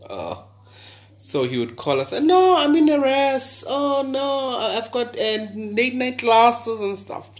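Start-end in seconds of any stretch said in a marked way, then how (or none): 2.1-7.3 mock innocent excuse. Retold lie to boyfriend.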